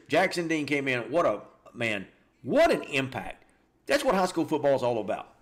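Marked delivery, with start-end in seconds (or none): none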